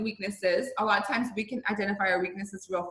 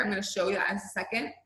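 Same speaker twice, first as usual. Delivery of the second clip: usual speaking voice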